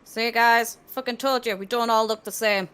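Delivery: triumphant shout. Acted. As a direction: crow it at the party. Player shouts triumphantly in character.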